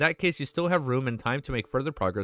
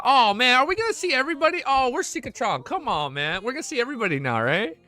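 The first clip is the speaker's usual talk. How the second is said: excited; 10 semitones higher than usual. Real, not acted. Excited to see the old crew.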